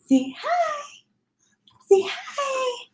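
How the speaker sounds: very high, breathy squeak. Real, not acted. Mom squeaking at her son to say hi, very high baby talk.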